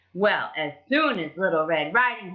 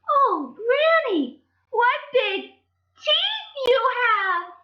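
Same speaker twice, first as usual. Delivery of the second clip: put-on very high, slow, loud alarmed child; scared Little Red; 4 dB louder, much slower than usual. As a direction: cry out at the teeth in mock fright.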